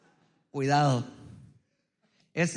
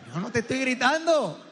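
higher, heated mock denial; escalating denial; 4 semitones higher than usual. Comedian acts out man losing the argument, denial rising toward yelling. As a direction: deny yelling while the voice climbs. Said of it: "Ya gritaste".

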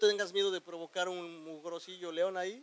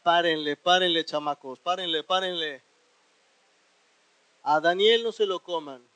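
louder, stern order to stop; firm command to lions; 8 dB louder than usual. Acted. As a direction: call the lions off firmly. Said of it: "les dijo".

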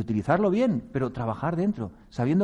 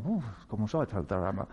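doubtful sigh; 4 semitones lower, 6 dB quieter than usual. Acted. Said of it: "Y dice".

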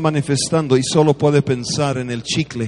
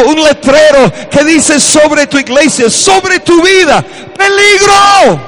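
shouted, much higher and louder, stretched words; preaching at full force; 11 semitones higher, 7 dB louder than usual. Acted. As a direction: preach like the roof is on fire. Preacher building to climax. (predicando a gritos con fervor)

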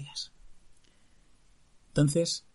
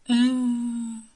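high, louder lovesick moan; 9 semitones higher, 7 dB louder than usual. Acted. Sick prince moans in bed, lovesick. Prince moaning in his sickbed.

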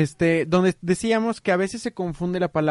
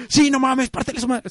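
raised-pitch fight-fan cheer; mock inner cheering, pitched up; excited inner man; 5 semitones higher than usual. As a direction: egg the boxer on, thrilled. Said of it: "nuestro hombrecito dentro está de".

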